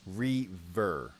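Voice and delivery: Reaver in two slow, separate syllables; pronounced Reaver. Shown as a demonstration. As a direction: spell out Reaver by ear, REE... ver. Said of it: "says it is pronounced Reaver".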